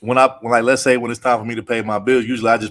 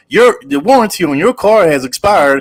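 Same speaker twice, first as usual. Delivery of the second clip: higher, slower robocall impression; lightly mimicked automated message; 6 semitones higher, 5 dB louder than usual. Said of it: "automated thing that says".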